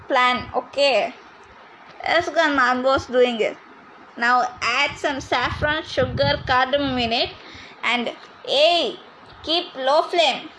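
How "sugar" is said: ordinary talk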